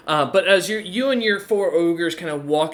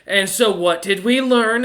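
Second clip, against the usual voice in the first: higher, louder mock lesson; lightly voiced woman taunting her victim; taunting Rain; 5 semitones higher, 5 dB louder than usual. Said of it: "she just looks down at him and goes".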